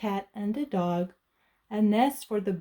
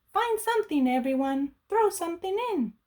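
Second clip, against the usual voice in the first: higher, quick cheerful invitation; higher happy call to friends; grinning witch; 9 semitones higher than usual. Acted. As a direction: grin while you call everyone. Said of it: "said with a grin".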